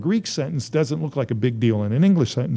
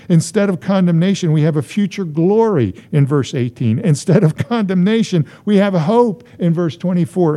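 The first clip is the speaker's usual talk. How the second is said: higher, driving sermon refrain; impassioned preaching; 5 semitones higher, 4 dB louder than usual. Real, not acted. Preacher listing blessings to the congregation, impassioned preaching. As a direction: hit each repeated phrase harder, building the list.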